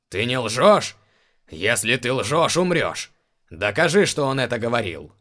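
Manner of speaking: loud, commanding furious demand; angry threat. Acted. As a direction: threaten him with death. Enraged emperor threatens death.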